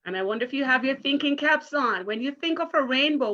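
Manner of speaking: normal talking voice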